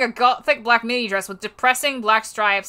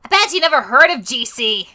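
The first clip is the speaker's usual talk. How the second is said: taunting character voice; 3 semitones higher, 5 dB louder than usual. Acted. Ibuki taunting in story.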